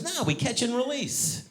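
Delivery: high-pitched imitation of the buddy; earnest buddy. Buddy explaining his fishing, mock earnest. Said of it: "That's what he kept saying".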